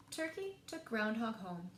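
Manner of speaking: ordinary delivery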